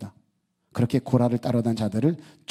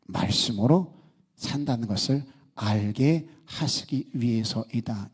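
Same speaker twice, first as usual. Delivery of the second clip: ordinary talk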